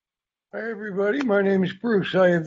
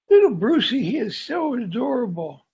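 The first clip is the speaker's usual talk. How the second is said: cooing aunties; 6 semitones higher than usual. Retold or imitated. Aunties cooing over toddler.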